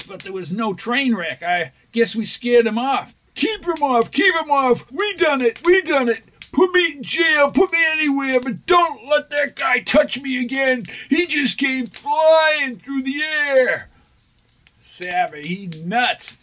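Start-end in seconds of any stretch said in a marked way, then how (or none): 3.3-13.9 high frantic begging; panicked pleading. Acted. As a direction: beg in terror, high. Frightened crook begs for jail.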